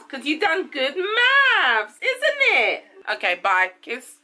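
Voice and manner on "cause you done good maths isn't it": slow, high, cooing praise. Real, not acted. Parent praising a child's maths, high proud baby talk.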